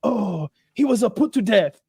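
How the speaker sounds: imitated Japanese-accented regret; mock Japanese lady. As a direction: break news in halting Japanese-accented English. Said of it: "She's like".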